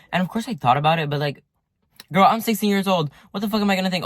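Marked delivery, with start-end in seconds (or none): 2.1-4.1 higher and louder, exasperated. Real, not acted. Teen defending herself to viewers, exasperated.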